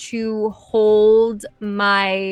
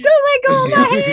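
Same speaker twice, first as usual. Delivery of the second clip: much higher, fast frantic plea; mock panic; 6 dB louder, much faster than usual. Player joining the bows' panic, frantic high plea.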